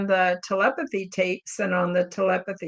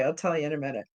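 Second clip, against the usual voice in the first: normal talking voice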